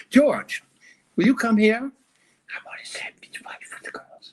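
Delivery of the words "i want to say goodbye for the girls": whisper. Acted. Husband whispers aside to George.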